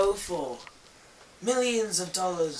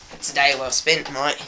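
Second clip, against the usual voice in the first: put-on Australian accent; 6 semitones lower than usual. Australian wildlife host act.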